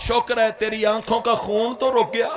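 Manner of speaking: ordinary talk, nothing marked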